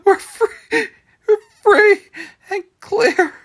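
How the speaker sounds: high, loud sobbing cry. Acted. Widow sobs to Willie at the grave. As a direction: let the sob break through. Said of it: "A sob rises in her throat".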